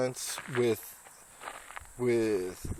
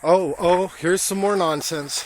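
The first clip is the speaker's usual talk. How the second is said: excited; 5 semitones higher, 7 dB louder than usual. Real, not acted. Excited find at the monument.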